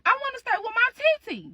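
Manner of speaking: high-pitched whiny niece; acted crying child. Niece begging to stay with her aunt, mock tearful whine. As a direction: plead like an upset little kid, voice way up. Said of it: "So your niece start crying".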